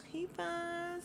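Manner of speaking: breathy swoon. Pretend. Host swooning over a man.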